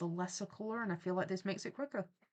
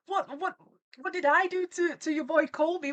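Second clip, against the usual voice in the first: mock protest; 7 semitones higher, 8 dB louder than usual. Protesting accusation.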